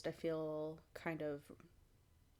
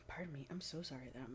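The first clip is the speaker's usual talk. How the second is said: whispering; 6 dB quieter, faster than usual. Real, not acted. Quick apology for hiccups, unvoiced.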